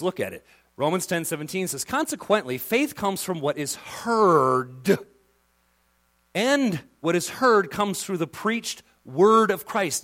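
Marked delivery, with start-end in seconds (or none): 1.8-10.0 scripture reading. Acted.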